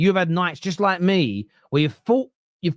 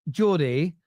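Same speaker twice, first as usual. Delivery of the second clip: ordinary talk, nothing marked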